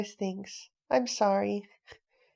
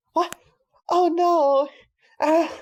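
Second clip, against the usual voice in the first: higher, louder gasp of dismay; shocked cry; 7 semitones higher, 5 dB louder, slower than usual. Acted. Boy cries out on seeing the wrecked wheat field. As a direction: react to the ruined field.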